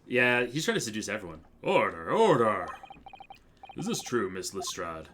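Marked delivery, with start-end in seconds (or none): 1.6-2.7 slow booming judge's call; calling for order. Acted. Judge silencing the courtroom, slow booming call. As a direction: bang the gavel with your voice.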